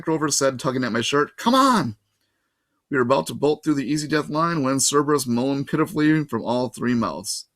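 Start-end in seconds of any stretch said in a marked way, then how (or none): 1.4-2.0 high, loud urging; urgent call. A narrator's reading. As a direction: hurry them along. Grover urging them to run.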